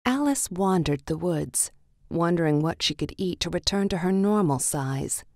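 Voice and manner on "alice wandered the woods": usual speaking voice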